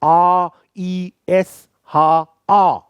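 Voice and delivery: slow, drawn German letter names; spelling slowly. Shown as a demonstration. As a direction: spell the name out for the class. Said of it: "उच्चारण करना है".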